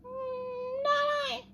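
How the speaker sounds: very high tiny mouse voice; squeaky mouse. Acted. Voicing the mouse.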